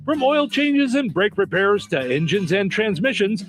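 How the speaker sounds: upbeat local radio ad voice; radio ad read. Acted. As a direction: sell it like a hometown radio spot. Reads a radio ad.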